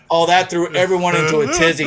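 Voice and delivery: usual speaking voice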